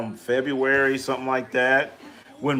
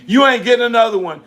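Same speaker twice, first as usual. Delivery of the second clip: louder, higher and fast taunt; taunting yell; 8 semitones higher, 6 dB louder, faster than usual. Real, not acted. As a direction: heckle the team on the screen. Taunting the team while watching.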